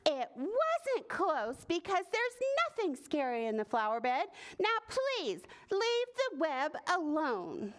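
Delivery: high, emphatic storybook scolding; exasperated shout. A narrator's reading. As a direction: scold as a fed-up spider. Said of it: "Spider shouted".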